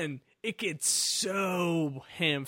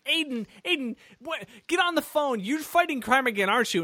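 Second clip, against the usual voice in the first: high-pitched, badgering imitation; mock nagging sister; 6 semitones higher than usual. She's calling Aiden after the crash, mock nagging sister. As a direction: pester him in a raised pitch. Sister nags hero on phone.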